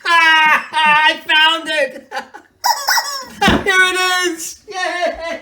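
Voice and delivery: high, loud, laughing joyous shout; elated shout. Acted. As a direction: yell it out, overjoyed at finding it. Found his sense of humor.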